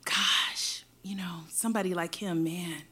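soft breathy whisper of longing. Retold or imitated. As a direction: whisper it to yourself, dreamy. Remembered longing thought.

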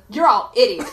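ordinary talk, nothing marked

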